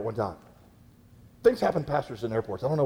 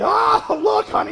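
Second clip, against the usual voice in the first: mock shout, pitched way up; loud older talker; 5 dB louder, slower than usual. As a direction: holler it so people far away can hear. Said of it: "talking loud enough for people in China to hear them".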